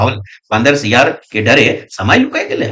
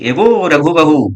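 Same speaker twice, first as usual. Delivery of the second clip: raised, slow call up high; 4 semitones higher, 5 dB louder, slower than usual. Retold or imitated. Parvati calls out to Raghu's wife, raised call. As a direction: call the daughter-in-law from outside. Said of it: "का कहले कि यहाँ कि".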